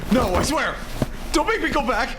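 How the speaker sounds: frantic final plea ending in a shrieking please; panicked pleading. Acted. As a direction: beg for your life, voice cracking upward. Marine begs not to be sent back.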